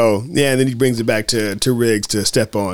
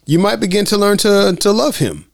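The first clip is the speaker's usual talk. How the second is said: lightly voiced coaxing, pitched higher; a hint of a movie wisecracker, higher voice; imitated Riggs; 8 semitones higher than usual. Riggs teasing Murtaugh with a setup, coaxing. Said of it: "He's like, you know".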